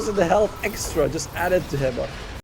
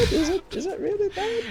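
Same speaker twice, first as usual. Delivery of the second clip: self-quoted question; 5 dB quieter than usual. Retold or imitated. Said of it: "and I'm like".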